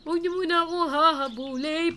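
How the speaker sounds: high, loud panicked yell; panicked shout. Real, not acted. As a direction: yell while running away. Being chased in game.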